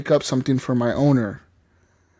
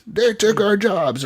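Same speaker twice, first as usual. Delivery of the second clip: slow, high-pitched mock protest chant; protester; 7 semitones higher, slower than usual. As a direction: draw it out like an aggrieved picketer. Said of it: "they're out there protesting".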